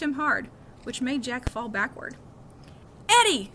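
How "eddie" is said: sharp high scream of a name. A narrator's reading. Girl screaming at the bully, loud and high. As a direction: shriek his name to stop him. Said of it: "screamed one of the girls".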